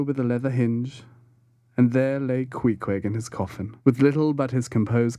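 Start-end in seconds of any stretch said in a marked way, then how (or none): none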